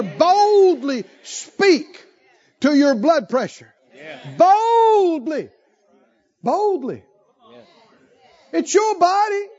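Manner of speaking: high, emphatic, punched phrases; full-force preaching. Real, not acted. Preacher exhorts congregation to command their bodies, bold and fervent. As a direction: hammer each short phrase high to rally them.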